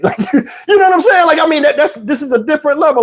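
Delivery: high laughing as he talks. Real, not acted. Comic cracking up at his own story, laughing. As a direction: laugh through the words.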